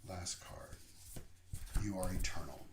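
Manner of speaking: ordinary talk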